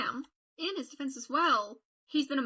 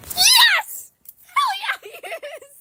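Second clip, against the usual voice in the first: very high, loud cheering squeal; excited yell tipping into laughter; ecstatic shriek; 14 dB louder, much slower than usual. Real, not acted. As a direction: scream it in delight. Cheering on favourite character.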